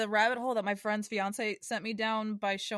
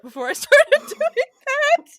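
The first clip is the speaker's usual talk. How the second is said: laughing; 15 dB louder, slower than usual. Real, not acted. Breaks up mid-reading.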